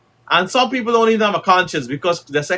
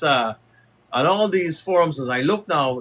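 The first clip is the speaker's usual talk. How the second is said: ordinary talk, nothing marked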